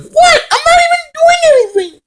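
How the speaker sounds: very high crying-whine mock shout; hammed-up bratty wail; whiny Taryn. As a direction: whine it like a sore loser caught cheating. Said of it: "And Taryn's like".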